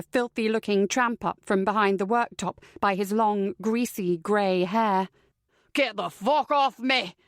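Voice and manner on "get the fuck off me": slow, raspy Scottish protest; angry Scottish shout. A narrator's reading. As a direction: roar it hoarsely in Scottish. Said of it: "the man shouts".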